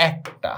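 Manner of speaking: একটা, softly, with an "æ" vowel; AE sound. Shown as a demonstration. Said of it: "এইটার উচ্চারণ হচ্ছে".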